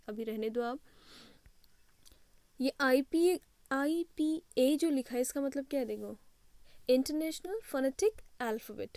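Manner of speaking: ordinary talk, nothing marked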